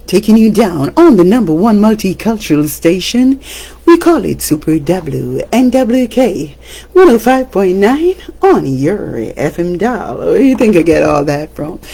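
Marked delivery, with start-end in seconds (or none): 0.1-10.2 a touch of the on-air DJ plug; radio announcer. Shown as a demonstration. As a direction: plug the station like a radio host. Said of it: "I'm a radio broadcaster".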